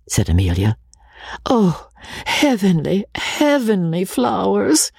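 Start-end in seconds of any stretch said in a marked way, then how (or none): 1.4-4.9 high, drawn-out feminine rapture; overdone ladylike delight; gushing exclamation. A narrator's reading. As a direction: swoon over a gift, slow and high. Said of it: "exclaimed Miss Sharp".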